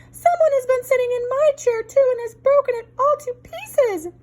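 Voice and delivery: put-on baby bear piping high and small; wee small voice. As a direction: squeak it like a small upset cub. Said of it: "in his wee small voice".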